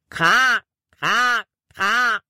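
high, drawn-out cawing like a crow; crow call imitation. A narrator's reading. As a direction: caw three times, each one arching up high. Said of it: "Das klang so echt, dass Libby kaum glauben konnte, dass es keine Krähe war". (hoher, gedehnter Krähenruf)

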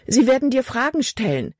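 hohe, gehetzte Mädchenstimme; ängstliches Mädchen. A narrator's reading. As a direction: sprich als verängstigtes Mädchen. Tochter warnt Vater aufgeregt. (Tochter drängt ihren Vater, hoch und ängstlich)

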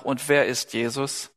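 normal talking voice

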